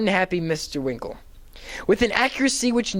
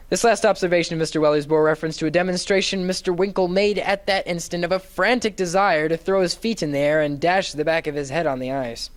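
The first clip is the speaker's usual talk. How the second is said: plain reading voice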